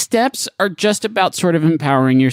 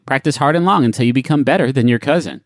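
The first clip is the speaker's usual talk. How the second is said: usual speaking voice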